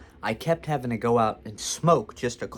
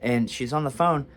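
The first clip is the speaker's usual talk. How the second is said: ordinary delivery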